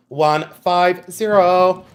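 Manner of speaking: ONE, FIVE, ZERO stretched slowly; drawn-out number. Shown as a demonstration. Seller announcing the price to live buyers, "One five zero" drawn out slowly.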